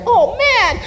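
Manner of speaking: very high comic wail; high-pitched whine of a runner being outdone; mock dismay. Acts dismay at being overtaken by runners.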